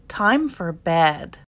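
slow, low and drowsy; sleepy yawn. Acted. Platypus, tired from collecting, yawns slowly toward bed. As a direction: yawn the words out, ready for bed.

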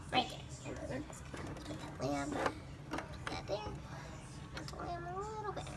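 soft whisper to herself. Real, not acted. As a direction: whisper while placing the lamp. Arranging dollhouse, whispering to self.

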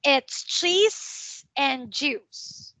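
slow, careful it's cheese and juice; slow model sentence. Shown as a demonstration. Teacher models the sentence slowly for Min. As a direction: spell out each word slowly for a child. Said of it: "Again, let's say".

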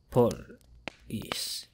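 whispered, lifeless and soft; eerie whisper. A narrator's reading. Corpse whispering through the dead officer's mouth. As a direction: breathe it out like a corpse.

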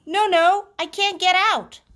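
high, loud protest; refusing little frog. A narrator's reading. Froggy refuses to get out.

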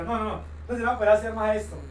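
ordinary talk, nothing marked

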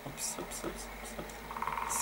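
barely audible whispered steps. Real, not acted. Gamer sneaking up in the game, whispered. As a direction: whisper it under your breath.

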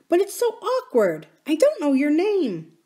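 put-on high child's voice; small girl owning up to awkwardness; little princess. Little princess wanting the miner boy's name, high.

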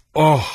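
high, loud "ah"; theatrical groan of agony; acted dying cry. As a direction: cry out in mortal pain. Dying queen cries out.

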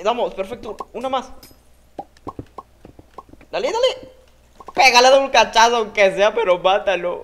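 high, loud urging on; excited cheering. Real, not acted. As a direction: cheer your teammate on hard. Cheering teammate to kill.

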